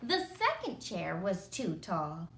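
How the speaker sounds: ordinary delivery, nothing marked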